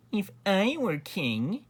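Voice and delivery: high, slow stage-whisper imitation; whispered scheming. A narrator's reading. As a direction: insinuate it slowly in a high voice. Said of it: "whispered the Grand Duke Wilfred".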